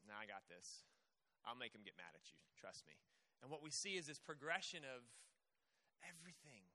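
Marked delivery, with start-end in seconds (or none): 0.1-2.9 low, quiet scheming devil; a low, sly tempter murmuring his bet. Retold or imitated. Devil boasting to God about Job, low and sly. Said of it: "And the devil goes".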